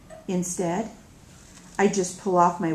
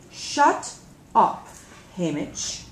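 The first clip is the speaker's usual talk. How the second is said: clear; 3 dB louder, slower than usual. Acted. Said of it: "I say clearly".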